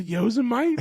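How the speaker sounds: "Yosemite" said as YOSE-MITE, high and amused; Yosemite mispronounced. Retold or imitated. Asking his bro about a trip, mispronounced Yosemite. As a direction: say "Yosemite" with the last part rhyming with bite. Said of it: "I knew this word was wrong".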